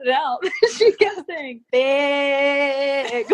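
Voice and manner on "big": long, stretched northern vowel in BIG; drawn-out northern accent. Retold or imitated. Teacher sounding out a word for a kid, northern accent. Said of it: "It was so long and drawn out".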